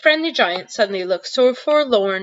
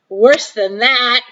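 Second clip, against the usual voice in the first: laughing; 4 semitones higher, 6 dB louder, much slower than usual. Acted. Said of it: "roaring with laughter".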